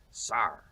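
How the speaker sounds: "sar" with open AR vowel and sounded R; Paul Revere pronunciation. Shown as a demonstration. Historian showing Revere's sir, "sar" with open AR. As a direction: say "sar" for sir, colonial style. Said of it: "Paul Revere would have said".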